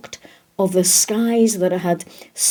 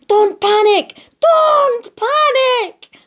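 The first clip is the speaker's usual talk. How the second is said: high-pitched panicky cry, second one drawn out; panicked shouting; 14 semitones higher, 4 dB louder, slower than usual. A narrator's reading. Stanley the stag running round in confusion, shouting in panic. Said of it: "ran round shouting".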